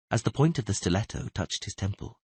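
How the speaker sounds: usual speaking voice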